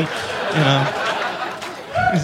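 ordinary delivery

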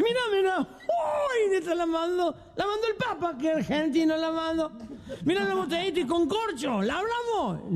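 put-on very high, excited drunk. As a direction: squeal over the bottle. Drunk finds bottle excitedly.

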